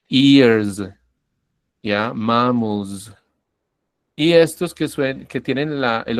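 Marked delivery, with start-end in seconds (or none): 0.1-0.8 "ears" said loudly with a buzzing z at the end. Shown as a demonstration. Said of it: "estoy diciéndolo con una Z". 2.1-3.1 "Mammals" with a buzzing z at the end. Shown as a demonstration. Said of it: "estoy diciéndolo con una Z".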